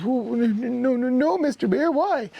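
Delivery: very high, rapid stammered denial; innocent little squirrel. Acted. Squirrel answering the bear's question, high innocent stammer. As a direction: stammer it out in a tiny squeaky voice. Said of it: "The squirrel goes".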